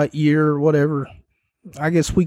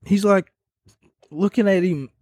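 ordinary talk

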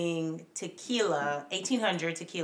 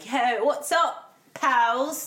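put-on British accent; 5 semitones higher, 5 dB louder, much slower than usual. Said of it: "thinking you a Brit".

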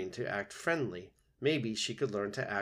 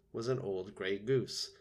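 usual speaking voice

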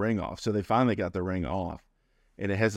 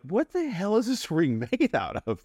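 high, incredulous voice with a laugh; family baffled by the ring, high and laughing; mock disbelief; 10 semitones higher than usual. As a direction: pitch it up in amused disbelief. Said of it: "we're like".

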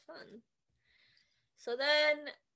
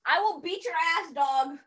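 slightly higher, louder tough guy; a hint of a thug; mock tough threat; 5 semitones higher, 5 dB louder than usual. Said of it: "my anxiety was like".

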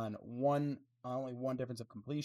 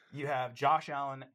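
loud, firm restart to retake the floor; louder restart; 9 dB louder than usual. Real, not acted. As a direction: say Josh Allen much louder. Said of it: "Oh, we're gonna talk about Rudolph".

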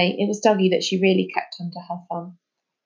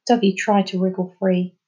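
ordinary delivery

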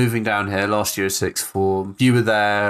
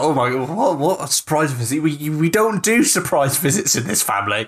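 acted surprise; 6 semitones higher than usual. Acts out shock at surprise visit.

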